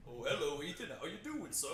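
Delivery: lightly mocking impression of a deep-voiced man; mock-happy greeting with a put-on voice; Luther. Co-host imitates the actor greeting Ethan, lightly put-on deep voice. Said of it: "It's too deep to really".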